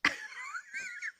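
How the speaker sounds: high-pitched burst of laughter at her own impression; high giggle. Real, not acted. Laughing at her own accidental impression, high giggle.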